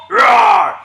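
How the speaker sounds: high, loud playful roar; mock roar. Shown as a demonstration. Coach recapping the Hulk command: high playful mock roar. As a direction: squeal out a cartoon roar. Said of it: "So we have Hulk".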